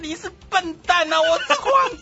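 extremely high, hammed-up squeal; panicked shouting. A narrator's reading. Flustered emperor protesting to his attacker, panicked high shout. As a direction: screech indignantly in flustered alarm. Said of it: "这慌慌张张的皇帝就大叫着".

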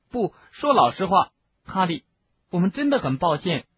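high, pleading sincerity; desperate apology. A narrator's reading. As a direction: plead urgently to be forgiven. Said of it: "赫敏不顾一切地说".

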